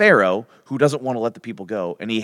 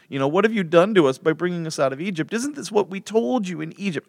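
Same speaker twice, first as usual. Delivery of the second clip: whiny voice; 9 semitones higher than usual. Acted. Israelites complaining to Moses in the desert, mock whiny. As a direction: whine it like a hammed-up crybaby. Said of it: "I have to do this in a whiny voice".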